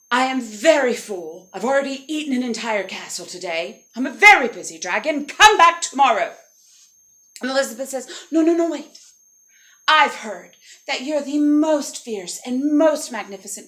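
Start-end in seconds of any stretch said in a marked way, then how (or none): 0.1-5.2 loud, heavily stressed dragon; irritated dragon. Acted. Dragon repeats dismissal, annoyed. 5.2-6.4 loud, high dragon dismissal; exasperated dragon. Acted. Dragon's second, firmer dismissal. 8.3-13.7 higher, louder, emphatic praise; flattering princess. Acted. Elizabeth flatters the dragon.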